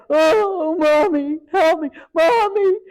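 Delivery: put-on high, loud pleading child voice; child crying for mommy. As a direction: imitate a scared kid begging his mother for help. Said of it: "that cry to a mother".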